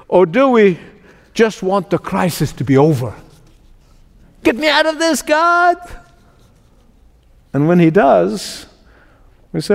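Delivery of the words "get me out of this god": high-pitched mock cry to God; desperate plea. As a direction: beg to be rescued, acting it out. Acting out a crisis prayer.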